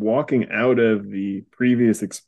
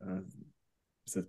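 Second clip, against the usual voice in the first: ordinary talk, nothing marked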